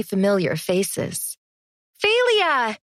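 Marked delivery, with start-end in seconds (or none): 2.0-2.8 high, loud happy greeting; excited cry. A narrator's reading. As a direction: call out her name with delight. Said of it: "I cried".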